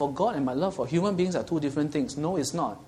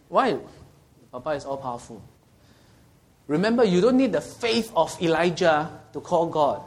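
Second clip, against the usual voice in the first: ordinary delivery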